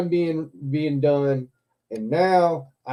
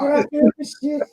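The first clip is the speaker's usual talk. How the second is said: "should I film this shit" pitched much higher; higher retake; 10 semitones higher than usual. Retold or imitated. YouTuber repeats his question to friends, pitched high. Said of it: "I do the YouTube thing".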